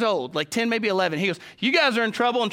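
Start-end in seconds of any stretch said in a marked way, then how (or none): 1.6-2.4 put-on higher, louder kid taunt; a gleeful singsong jab; taunting big brother. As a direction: tease the little brothers like a know-it-all ten-year-old.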